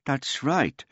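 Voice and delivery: put-on shy, high old man reply; bashful old man. A narrator's reading. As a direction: answer shyly, a little proud. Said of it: "the old man said bashfully".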